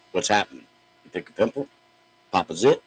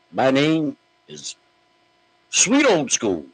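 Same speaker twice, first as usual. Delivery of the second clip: slow, higher mock wrestler intro; mock ring introduction; 7 semitones higher, 3 dB louder, slower than usual. Host mocking a gimmick thief announcing his new name. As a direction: announce yourself like a copycat wrestler. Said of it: "coming out tomorrow and saying".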